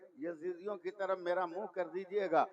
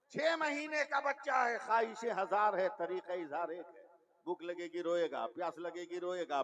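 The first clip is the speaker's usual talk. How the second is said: normal talking voice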